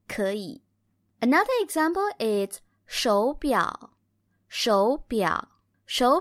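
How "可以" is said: 可以 with 可 rising, 以 low; 可以 with the tone change on 可; second then third tone. Shown as a demonstration. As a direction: say 可以 as a rising tone into a dipping one. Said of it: "we say second tone and the third tone".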